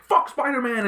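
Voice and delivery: high-pitched mock ranting; mock outrage. Voicing the Daily Bugle against Spider-Man, high mock outrage. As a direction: squawk it like a hostile headline.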